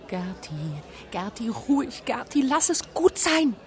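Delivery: urgent, rising in pitch; pleading hush; hushing Gerti. A narrator's reading.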